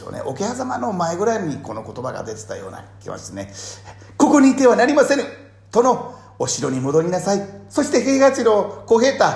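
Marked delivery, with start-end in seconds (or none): 4.2-9.2 high, loud urgent orders; urgent command. Retold or imitated. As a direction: send them off urgently. Voicing Sena urging.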